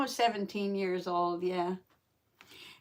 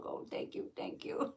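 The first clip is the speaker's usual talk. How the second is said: whispered thank you while hugging; 10 dB quieter than usual. Real, not acted. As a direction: breathe the thanks without voice. Hugging the cat.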